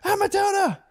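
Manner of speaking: put-on high shrieking little kid voices; kids screaming. Dad imitating his kids shrieking at the bunny in high voices. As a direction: shriek it like excited small children.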